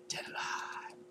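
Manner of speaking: breathy whispered repeat of the title. Real, not acted. Vlogger savouring his favourite film's name, reverent whisper. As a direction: whisper it back in awe.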